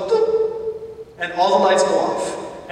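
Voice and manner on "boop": a sudden high "boop"; mimicking the bus cutting out, high; high boop noise. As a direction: squeak out the bus dying. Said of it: "the bus goes".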